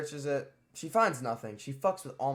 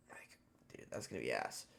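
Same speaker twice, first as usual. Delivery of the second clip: whispered aside; 12 semitones lower, 9 dB quieter than usual. Real, not acted. Teen dreading a sport suspension, whispering.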